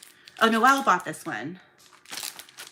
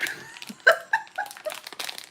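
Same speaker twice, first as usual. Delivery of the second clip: laughing. Real, not acted. Packing orders, laughs at own joke. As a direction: giggle high and breathy.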